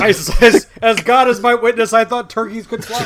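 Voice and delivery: hammed-up sitcom quote; quoting a TV line. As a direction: deliver the famous line with mock earnest. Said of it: "Best lines ever".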